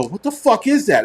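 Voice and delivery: high-pitched mock horror; retold shock. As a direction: react as if appalled, pitch jumping up. Retelling horror at the pancakes.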